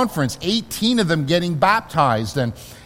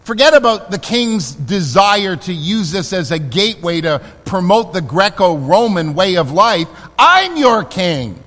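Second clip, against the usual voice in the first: voicing Jesus; 3 semitones higher, 4 dB louder than usual. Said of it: "Jesus to really say".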